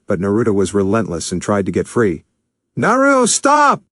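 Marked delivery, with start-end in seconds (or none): 2.8-3.8 very high, loud yell to stop; loud shout. A narrator's reading. Joe yelling while pulling Naruto back, very high. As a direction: bellow at him to stop. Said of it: "Joe shouted".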